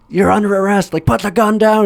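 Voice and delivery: high loud command; mock cop order. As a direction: order him to drop it. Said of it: "the cop's like".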